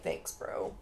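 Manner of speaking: low, hushed throwaway reply; muted mock thanks. Danny answers the villagers' congratulations, muted mock thanks. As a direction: drop it low and soft, imitating an underwhelmed winner.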